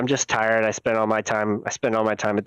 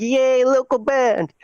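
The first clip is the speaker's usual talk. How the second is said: mock cheer; 11 semitones higher, 4 dB louder, slower than usual.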